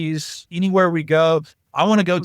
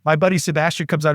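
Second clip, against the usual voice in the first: normal talking voice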